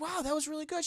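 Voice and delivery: high, soft praise for a kid's playing; mom's soft, high praise; impressed mom. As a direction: gush softly like a proud new mother. [name] praising Esther's piano.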